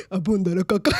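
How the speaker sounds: punchline breaking into laughter; laughing punchline. Retold or imitated. Giving the joke's answer, cracking up. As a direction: crack up while delivering the punchline.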